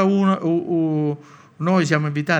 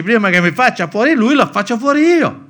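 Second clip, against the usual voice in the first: higher and louder, a mock threat hurled out; half-acted angry vow; vengeful outburst; 7 semitones higher, 8 dB louder than usual. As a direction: play the man fed up enough to strike first. Imagined revenge thought, hypothetical quote.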